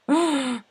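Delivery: sharp high gasp; a high, loud gasp at chocolate-covered treats; shocked gasp. Real, not acted. Reaction to Nutter Butters.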